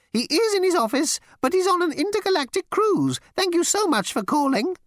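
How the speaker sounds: high, fussy insect voice; insect receptionist. Acted. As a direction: squeak it like a prissy bug.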